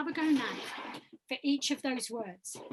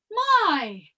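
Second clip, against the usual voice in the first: "My" called out high and loud; high-placed word; 5 semitones higher, 8 dB louder than usual. Shown as a demonstration. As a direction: send "My" up high, loud and falling. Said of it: "I want the sound to be high".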